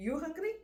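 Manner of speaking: "you hungry?" rising high to the end; question intonation. Shown as a demonstration. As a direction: ask "you hungry?" with the pitch climbing. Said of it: "ഒരു question മാർക്കിൻ്റെ ഒരു രൂപത്തിൽ".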